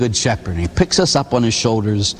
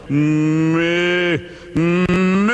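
mooing like a calf, rising; lowing calf voice; 3 semitones higher than usual. Retold or imitated. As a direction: moo it out like a bull calf. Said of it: "he's going".

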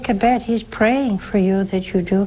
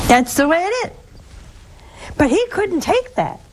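higher, fervent preaching voice; impassioned preaching; 5 semitones higher than usual. Acted. Preaching to the audience about Christ's presence, impassioned and raised. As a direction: preach it with rising wonder.

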